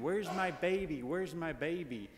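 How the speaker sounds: put-on high, loud frantic cry of an old grandmother; imitating grandma yelling for her baby; mock screaming. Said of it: "she was just screaming".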